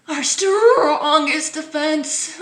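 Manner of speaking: slow, high and loud mock quote; mock official. Vlogger mocking the health message, slow mock voice. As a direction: parody the official line slowly.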